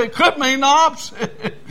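high, laughing punchline; laughing quip. Retold or imitated. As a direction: crack the joke through a laugh. Pastor laughing at his own joke.

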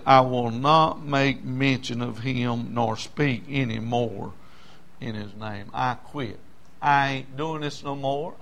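low, hushed giving up; defeated resignation. Acted. Jeremiah telling God he quits, quiet resignation. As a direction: drop right down and slow, as if worn out.

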